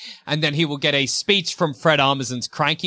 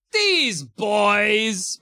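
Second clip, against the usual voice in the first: mock exasperated girl; 6 semitones higher than usual. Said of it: "sensible girl who's like".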